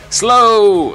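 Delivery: shout. Real, not acted. Shouting at game.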